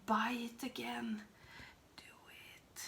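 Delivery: whispered nudge to buy; conspiratorial whisper. Pretend. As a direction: lean in and whisper the push. Urging viewers to buy palette.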